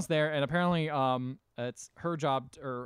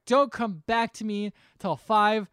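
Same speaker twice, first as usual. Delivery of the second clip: higher, louder, drawn-out retold order; mock angry outburst; 7 semitones higher, 6 dB louder, slower than usual. Girl sending boy away before slamming door, mock anger. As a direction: send him away in a slow, hammed-up yell.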